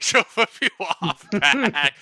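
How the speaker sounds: loud, slow, breaking into real laughter; laughing retort. Real, not acted. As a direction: laugh it out loudly, stretching the words. Game master cracks up at exchange.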